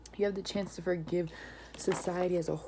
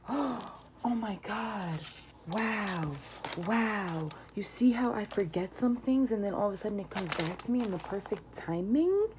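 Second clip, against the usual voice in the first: gasping, rising high into an excited near-whisper; real delight breaking through; 4 semitones higher, 3 dB louder than usual. Real, not acted. Reader realizing the cards match what she said, thrilled. Sudden realization during card reading.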